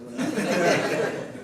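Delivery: slow, loud line said through laughter; slow laughing quip. Real, not acted. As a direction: laugh out the punchline.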